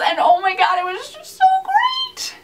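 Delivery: high unvoiced teary whisper-squeal; overwhelmed squeal. Real, not acted. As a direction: let it crack into a breathless teary gasp. Fan overwhelmed by a scene.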